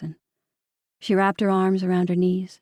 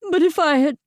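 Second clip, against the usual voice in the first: breaking down; 8 semitones higher, 5 dB louder than usual. A narrator's reading. Carol breaks down, higher.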